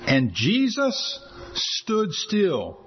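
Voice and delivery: very slow, each word drawn out; hushed, awed slow-motion reading; slow dramatic reading. Real, not acted. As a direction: let the moment hang, word by word. Preacher dramatizes climactic verse.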